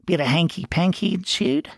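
brash Ferret, guessing. Acted.

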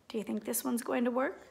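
plain reading voice

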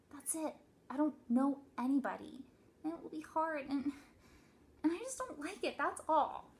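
put-on high, emphatic child's complaint; protesting child. A narrator's reading. Daughter dreading new school, high-pitched protest.

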